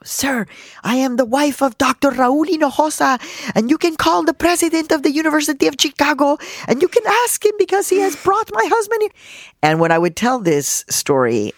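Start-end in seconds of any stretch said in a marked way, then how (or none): 0.0-9.1 high, loud, mother standing her ground; retold screaming. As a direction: declaim it in a big, indignant voice. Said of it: "she started screaming at him".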